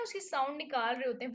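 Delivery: ordinary talk, nothing marked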